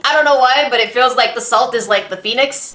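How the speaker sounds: ordinary delivery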